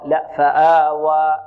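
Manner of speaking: فآوى drawn out slowly, no glottal stop at the end; slow model recitation. Shown as a demonstration. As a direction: say فآوى slowly, ending on an open alif. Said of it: "لا نختم بهمزة". (نطق فآوى ببطء دون همزة في آخرها)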